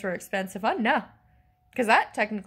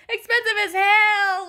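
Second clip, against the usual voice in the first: high, loud, slow outburst; real frustrated yell, pitched way up; exasperated shout; 11 semitones higher, 7 dB louder, slower than usual. Real, not acted. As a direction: shout it out, stretching every word. Repeats it as an outburst.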